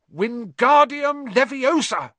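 loud, slow, drawn-out shout; shouted spell. A narrator's reading. Ron shouting the spell at his feather, loud and slow. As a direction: bellow the spell at a stubborn feather. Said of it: "he shouted".

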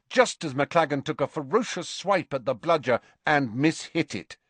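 usual speaking voice

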